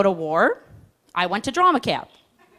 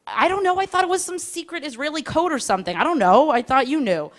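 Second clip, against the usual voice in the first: put-on defensive young self; 7 semitones higher than usual. Herself answering defensively.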